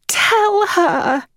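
high, trembling dramatic command; throbbing accents. A narrator's reading. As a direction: throb with tragedy. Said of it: "she said in throbbing accents".

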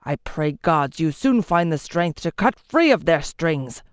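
angry declaration. A narrator's reading. Lord to the king, angry vow. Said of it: "He turned to the king and declared".

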